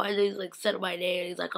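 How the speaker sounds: ordinary talk, nothing marked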